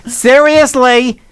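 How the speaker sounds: slow, laughing Woodstock stage announcer; festival announcer. Retold or imitated. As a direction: warn the crowd like a Woodstock MC. Imitating hippie festival announcer.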